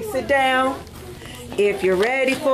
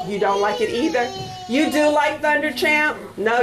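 ordinary delivery

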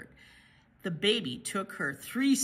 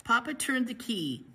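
ordinary delivery, nothing marked

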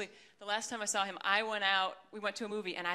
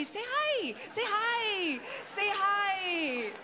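very high singsong cooing, getting more drawn-out and insistent; mock mum pestering a toddler, stretched squeal building; imitated coaxing mother; 11 semitones higher, slower than usual. Mother coaxing her son to say hi on the phone, mock singsong.

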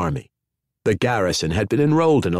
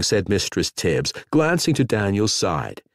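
plain reading voice

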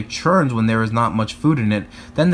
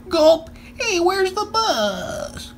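put-on startled kid; much slower than usual. Kids land somewhere unknown.

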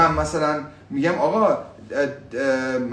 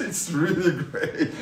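laughing delight; much slower than usual. Real, not acted. Laughing joy at the live.

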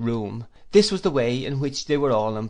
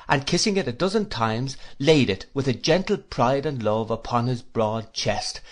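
ordinary delivery

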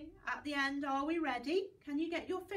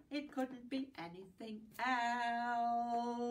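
slow; slower than usual. Shown as a demonstration. Rhyme closing line.

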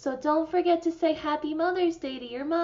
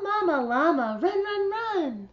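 higher, louder urgent call; 4 semitones higher, 4 dB louder than usual. Acted. Baby llama calls out for his mama, higher urgent call.

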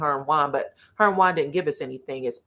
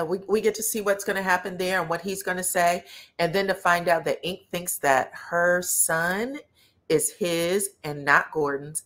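usual speaking voice

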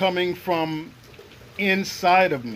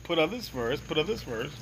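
soft, understated repetition; inner voice message; 8 dB quieter than usual. Retold or imitated. Message heard while on the ground, soft understated inner voice. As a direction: murmur it evenly like a background message. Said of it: "the message".

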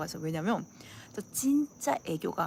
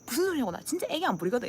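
quick, higher indignant retort; protesting; 4 semitones higher, faster than usual. Retold or imitated. Retorting to friends' teasing, indignant protest. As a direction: deny it fast, put out. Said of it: "이러니까".

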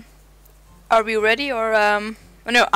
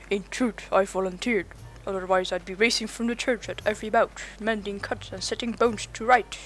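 lightly voiced village healer; character voice; 7 dB quieter than usual. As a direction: read it as the healer, only slightly changed. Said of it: "How was that voice?".

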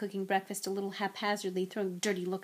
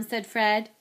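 ordinary delivery, nothing marked

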